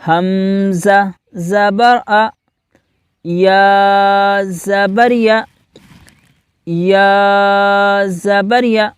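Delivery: very slow, deliberate letter-by-letter chant; slow recitation drill. Shown as a demonstration. Teacher leading pupils in slow drill. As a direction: recite each syllable for the class to repeat. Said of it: "آپ بھی میرے ساتھ دہرائیں".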